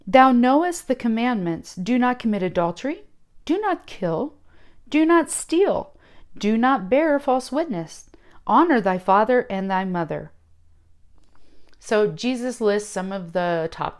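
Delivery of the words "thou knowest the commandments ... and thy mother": solemn King James reading; scripture reading. Acted.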